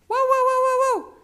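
high loud woofing; dog barking. Acted. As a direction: bark like a fierce dog. Ladrido del perro. (ladridos fuertes de perro)

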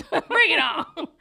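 high, very loud holler; playful shouted challenge. Pretend. Host daring Mercury retrograde, playful shouted challenge. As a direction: holler it out like a mock dare.

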